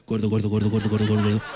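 a deep chorus of thoughts taunting; low mocking chant; mind voices. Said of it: "lo único que oirías todo el tiempo sería".

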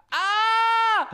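high, drawn-out cry of dismay; high wailing "ah" of frustration; frustrated scream. Real, not acted. Cries out over blunder.

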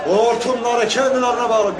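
high, loud, slow rally-stage yell; slow, loud, high stump-speech shout. Shown as a demonstration. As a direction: bellow it into the mic like a rally crowd-pleaser. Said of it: "şöyle bağırıyorum".

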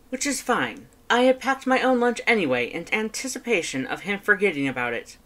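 high, loud, fast boastful skeleton; Papyrus voice. Acted. Papyrus reporting on his date to his brother, high and loud. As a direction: rattle it off proudly, high and loud.

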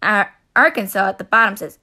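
usual speaking voice

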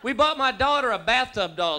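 ordinary delivery

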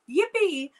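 a high, loud little yippee; excited cheer. Real, not acted. Host celebrating the winner, high and loud cheer.

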